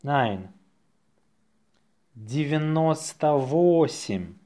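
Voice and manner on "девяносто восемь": slow, drawn-out dictation. Shown as a demonstration. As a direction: say it slowly for learners. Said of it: "say it slowly".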